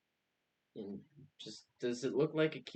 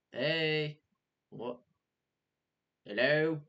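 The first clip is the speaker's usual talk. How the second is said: loud, raised call into the bushes; 4 semitones higher, 7 dB louder, slower than usual. Acted. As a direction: call out to whoever hides there. Calling into the bush.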